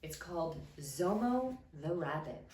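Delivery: ordinary delivery, nothing marked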